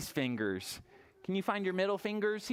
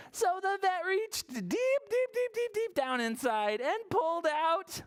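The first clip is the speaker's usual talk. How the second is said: building suspense; 12 semitones higher than usual. A narrator's reading. Reader showing vet reaching inside George, high suspense. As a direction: dig further with every high-pitched word.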